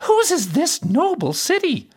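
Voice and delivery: high-pitched princess voice, questioning. A narrator's reading. Princess asking the fiddler who owns the city, high-voiced. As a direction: ask in a very high character voice.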